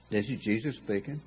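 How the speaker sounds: ordinary talk, nothing marked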